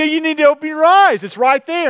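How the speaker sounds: high, loud mock scolding; scolding officer. Officer scolds driver who missed a stop sign, loudly exasperated. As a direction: play an exasperated cop telling off a driver.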